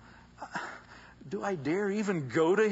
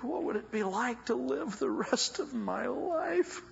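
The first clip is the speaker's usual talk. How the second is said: voicing the woman; 8 semitones higher, 4 dB quieter than usual. Preacher voices the desperate woman.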